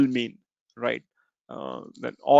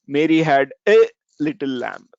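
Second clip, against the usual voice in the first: "a" stretched and louder, said as ay; stress on a; 4 dB louder than usual. Shown as a demonstration. Teacher stresses "a" to show the single lamb. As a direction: lean hard on the little word "a". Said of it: "emphasize each word".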